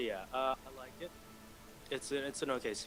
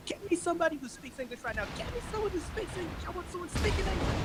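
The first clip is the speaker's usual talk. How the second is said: extremely high screechy rant; hammed-up shrieking caller, quick start; irate customer; 4 dB louder than usual. Customer refusing the supervisor's help, high mock fury.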